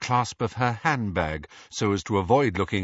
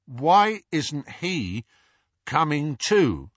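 slow, louder, deliberate asking again; Dudley slowly insisting on an answer; slow repeated question; 6 semitones higher, 4 dB louder, much slower than usual. A narrator's reading. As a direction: drag it out, plodding and stubborn. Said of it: "asked Dudley".

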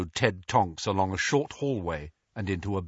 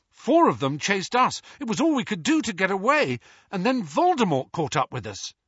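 urgent account; 7 semitones higher than usual. A narrator's reading. Harry explaining urgently.

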